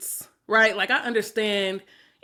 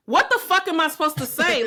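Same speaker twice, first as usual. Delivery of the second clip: higher, indignant acted comeback; exasperated retort; 7 semitones higher, 4 dB louder than usual. Woman retelling her reply to a date's complaint, exasperated. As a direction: throw it back at him, exasperated.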